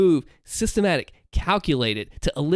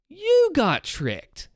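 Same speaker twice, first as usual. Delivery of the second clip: "you" jumps much higher, slow; stress on you; 11 semitones higher, much slower than usual. Shown as a demonstration. Creator replaying the reveal, "you" pitched high and slow. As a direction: pitch "you" way up, then drop "got tricked". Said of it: "you are the main subject and the emphasis".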